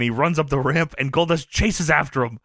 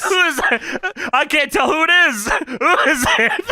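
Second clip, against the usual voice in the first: high, louder mock screaming questions; 9 semitones higher, 3 dB louder than usual. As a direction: yell it high, fake-confused. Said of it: "[name]'s just screaming".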